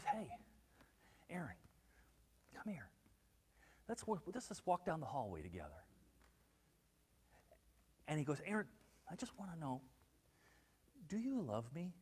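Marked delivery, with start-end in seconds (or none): 0.0-5.8 very soft, low invitation with pauses; quiet, confiding. Retold or imitated. Jesus calling Aaron aside in the foyer, quiet and confiding. 8.5-11.9 very soft, intimate, with pauses; gentle private question. Retold or imitated. Jesus asking Aaron alone, quiet and searching.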